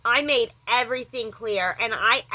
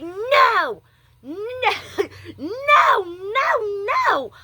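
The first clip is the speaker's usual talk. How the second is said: high, loud crying protests; distressed crying no; 10 semitones higher, 4 dB louder, much slower than usual. Real, not acted. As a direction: cry out no over and over. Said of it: "I flipped out".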